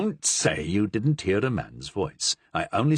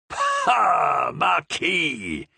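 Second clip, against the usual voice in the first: mocking exclamation; 5 semitones higher, much slower than usual. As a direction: scoff at the marquis.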